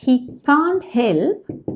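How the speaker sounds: very slow, each word drawn out; slow model phrase. Shown as a demonstration. Teacher modelling a phrase for Malayalam learners, very slow. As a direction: spell it out slowly for learners. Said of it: "teacher models phrase".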